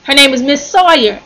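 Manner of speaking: normal talking voice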